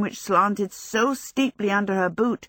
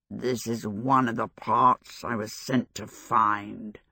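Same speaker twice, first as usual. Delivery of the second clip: low, slow, mushy voice of a stuffed scarecrow; 8 semitones lower, 3 dB quieter, slower than usual. A narrator's reading. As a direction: speak as if your mouth were full of damp straw. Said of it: "it said in its mushy voice".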